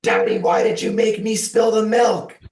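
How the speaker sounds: put-on high, loud, wailing little-girl scream; child's scream. Father retells his small daughter screaming at him. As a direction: scream it like a five-year-old who spilled the milk. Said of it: "just screamed".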